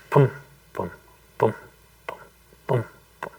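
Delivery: short booms with a beat of silence between; imitated heartbeat. Telling listeners about the workshop's background sound, imitated heartbeat.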